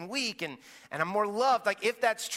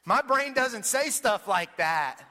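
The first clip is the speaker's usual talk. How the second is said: put-on higher, louder kid voice, laughing as he protests; a boy laughing, higher and loud; laughing child; 7 semitones higher, 5 dB louder than usual. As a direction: play the giggling son. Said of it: "He said".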